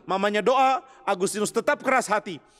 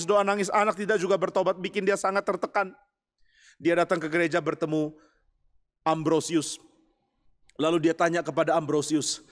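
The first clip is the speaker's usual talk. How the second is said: ordinary delivery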